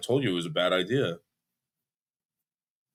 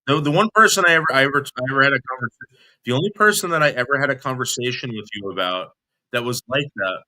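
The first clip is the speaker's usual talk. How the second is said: louder and higher, pressing the point; heated; 4 semitones higher, 6 dB louder than usual. Real, not acted. Correcting the caller mid-argument, heated. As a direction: push back hard. Arguing on a call.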